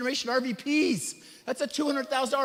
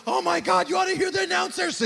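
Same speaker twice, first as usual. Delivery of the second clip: much higher, louder excited gush; imitated thrilled relative; 6 semitones higher, 5 dB louder than usual. Mother-in-law calling about the announcers, excited.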